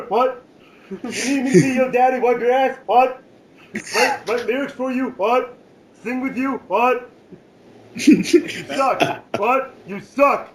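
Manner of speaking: hammed-up wrestler taunts; mock Stone Cold heckling.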